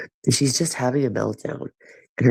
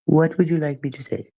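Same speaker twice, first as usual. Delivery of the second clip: higher, prim mock-polite ask; put-on prissy voice; 6 semitones higher than usual. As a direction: repeat it as a prim, overly polite character. Repeats question in a put-on voice.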